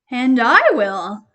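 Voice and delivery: loud, slow eager "I will"; eager cat. Acted. Cat to hen, loud eager yes. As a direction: stretch it eagerly.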